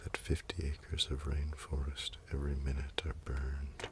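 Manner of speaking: telling a sad comic scene to viewers, in a mock-tragic hushed voice. Mock-solemn narration dying away to near silence.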